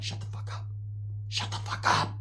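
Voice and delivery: whispered warning to a friend; urgent whisper. Retold or imitated. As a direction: whisper it so only he catches it. Secretly warning his friend to be quiet.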